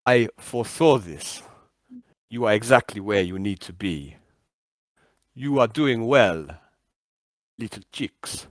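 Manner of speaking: deliberate all-knowing voice with falling phrases; Big Bird. Acted. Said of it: "the voice comes by and goes".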